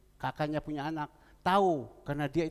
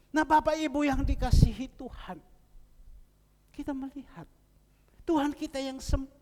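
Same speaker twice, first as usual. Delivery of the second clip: higher, louder opening to the flock; preaching address; 9 semitones higher, 5 dB louder than usual. Acted. Preacher turning to the congregation to praise the living God. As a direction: call the congregation to attention.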